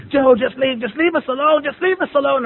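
high, repeated frantic plea, acted out; urgent pleading. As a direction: imitate a friend begging him off. Friend pleads amid yelling fight.

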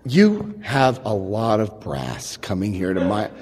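low, slow, soft-voiced reproach; cold confrontation. [name] confronting him at her door, low slow indignation. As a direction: drop low and measure out each word.

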